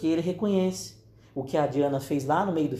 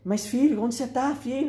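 put-on higher-pitched, anxious calling for a child; retold worried father; 9 semitones higher than usual. Father searching for his son, retold worried calling. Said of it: "ele começa a ficar preocupado".

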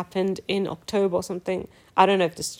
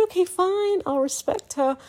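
a much higher, sing-song "okay, fine"; mock grudging agreement; 11 semitones higher than usual. Answering her mom's advice to respect old people, mock grudging. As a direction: give in with exaggerated reluctance. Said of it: "And I said".

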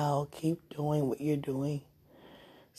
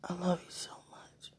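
whispering; 3 semitones higher, 10 dB quieter than usual. Real, not acted. Drowsy whispered goodbye.

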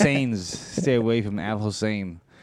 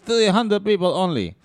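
proud friend; 7 semitones higher than usual. Retold or imitated. Friend voiced boasting about guests.

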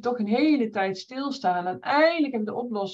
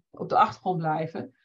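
ordinary talk, nothing marked

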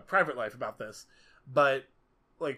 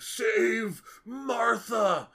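drawn-out, much higher, louder plea; desperate cry; 9 semitones higher, 7 dB louder, much slower than usual. Retold or imitated. Superman pinned under Batman's foot, mock desperate slow cry. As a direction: wail it slowly like a dying hero. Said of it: "Superman's like".

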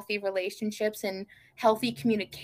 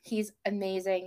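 ordinary talk, nothing marked

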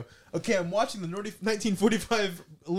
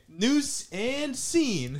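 slow, drawn-out announcement; mock announcer; 6 semitones higher, much slower than usual. Host announces the next improv scene, slow mock announcer. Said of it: "announcing the next scenario".

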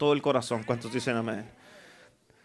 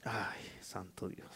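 slow, breathy whisper of exhaustion; whispered sigh; 11 semitones lower, 22 dB quieter, slower than usual. Real, not acted. Worn-out preacher sighing to himself, whispered. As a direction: sigh it under your breath, spent.

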